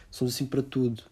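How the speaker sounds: ordinary talk, nothing marked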